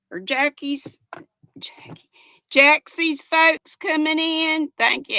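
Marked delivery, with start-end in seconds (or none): 1.6-2.1 unvoiced whisper to herself. Real, not acted. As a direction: breathe the name as an aside. Whispered self-correction.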